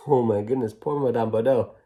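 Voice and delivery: usual speaking voice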